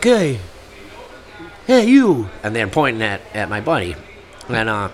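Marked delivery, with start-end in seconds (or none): 0.0-2.3 calling out. Retold or imitated. Group calling out.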